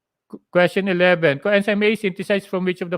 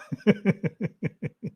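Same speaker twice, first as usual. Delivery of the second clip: light laugh; 4 semitones higher than usual. Real, not acted. Laughs at silent chat.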